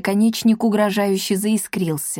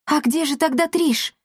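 higher-pitched, anxious question; worried; 7 semitones higher than usual. A narrator's reading. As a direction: ask it with rising worry. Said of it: "заволновалась Дарина". (взволнованно, с тревогой)

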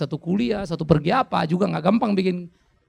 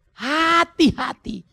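much higher, very slow and loud, falling at the end; drawn-out high warning; 11 semitones higher, 5 dB louder, much slower than usual. Acted. Pastor warning fathers about a slippery path, drawn-out high warning. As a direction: stretch the warning up high and let it fall.

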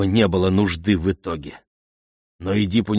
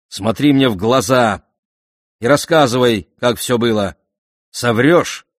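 loud, higher-pitched, forceful demand; barked royal order; 4 semitones higher, 7 dB louder than usual. A narrator's reading. King orders Antimed to tell everything, barking angrily. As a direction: command him angrily, punching each phrase loudly. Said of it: "рявкнул Эдип".

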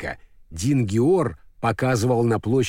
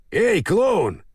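calling out; 6 semitones higher than usual. Acted. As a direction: shout for the clown. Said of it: "закричал он". (громкий оклик)

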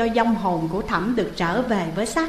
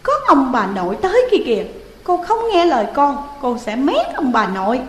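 put-on high, fast, loud sulky child; cranky child; 5 semitones higher, 5 dB louder, faster than usual. Young Thẩm grumpily threatening ghost Hiền, high fast and cross. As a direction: snap back like an annoyed kid. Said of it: "Thẩm phát quạo".

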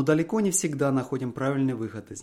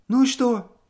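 high, louder anxious pressing; wife demanding the outcome; 10 semitones higher, 7 dB louder than usual. A narrator's reading. Wife presses husband for the loss, anxious.

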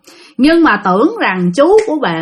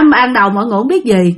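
ordinary delivery, nothing marked

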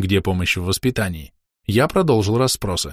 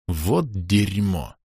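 slow, heavy, quieter curse; said with feeling; 3 semitones lower, 5 dB quieter, much slower than usual. A narrator's reading. Father stopping short in the corridor, cursing with feeling. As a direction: drag it out with real feeling. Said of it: "с чувством произнёс я".

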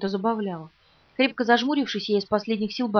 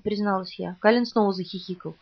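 ordinary delivery

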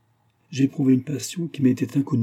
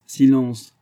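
louder, higher command for silence; sharp hushing; 4 semitones higher, 7 dB louder than usual. A narrator's reading. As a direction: cut the servant off firmly. Master hushes the old servant. (ordre de se taire, ton plus fort)

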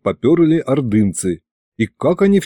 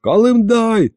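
high-pitched hail to an ally; urgent call; 9 semitones higher, slower than usual. Acted. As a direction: call out urgently for your man. Calling ally over pin communicator.

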